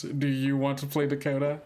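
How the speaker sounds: normal talking voice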